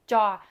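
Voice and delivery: "jaw" said loud with the tongue wide against the palate; tongue spread out. Shown as a demonstration. Teacher contrasts it with T, "jaw" with tongue spread out. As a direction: spread the tongue over the mouth's roof for "jaw". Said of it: "Your tongue is much more spread out".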